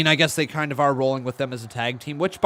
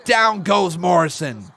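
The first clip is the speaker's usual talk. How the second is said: loud, high commentator calling the count; excited play-by-play; 6 semitones higher, 7 dB louder, much slower than usual. Acted. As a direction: call the action like a wrestling announcer. Calls the match like an announcer.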